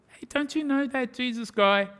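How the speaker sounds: high-pitched mock stage whisper, fully voiced; whispering servant girl. Servant girl to Peter late at night, mock whisper. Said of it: "single servant girl comes up and whispers".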